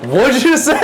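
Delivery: high outraged question; shocked squeak. Real, not acted. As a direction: jump up high in disbelief. Reacts to "good boy.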